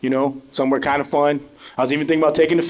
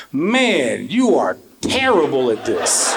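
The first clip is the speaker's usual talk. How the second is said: blunt put-down; 4 semitones lower, much slower than usual. Retold or imitated. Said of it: "I tell every single one of them".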